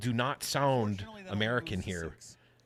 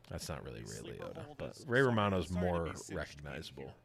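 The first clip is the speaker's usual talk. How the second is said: ordinary talk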